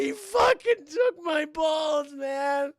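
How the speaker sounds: slow, high, loud mock-agonized wail; wailing wounded burglar. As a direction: howl through the pain, dragging out words. Wounded burglar wailing to his partner.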